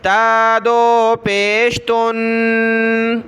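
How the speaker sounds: measured sing-song letter-by-letter recitation; slow classroom recitation drill; Qaida spelling drill. Shown as a demonstration. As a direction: chant each syllable, then blend and stretch the word.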